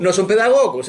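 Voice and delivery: emphatic echo; emphatic agreeing echo. Real, not acted. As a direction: repeat "No son pedagogos" loud and high to agree.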